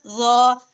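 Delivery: ज as a heavy, held "zaw". Shown as a demonstration. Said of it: "ज बहुत मोटा और ऊंचा है".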